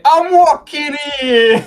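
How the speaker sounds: high, very slow and loud New York yell; New York shout. Pretend. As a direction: bellow it like a New York cabbie. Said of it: "never been more true to say".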